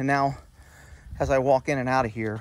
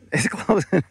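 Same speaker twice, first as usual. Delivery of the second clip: laughing; 4 semitones higher than usual. Real, not acted. Laughs while closing door.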